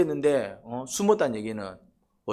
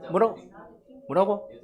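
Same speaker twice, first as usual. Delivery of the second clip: normal talking voice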